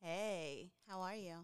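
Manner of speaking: faint, far from the mic; quiet voice too far from the mic; barely audible. Real, not acted. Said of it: "I can barely hear you".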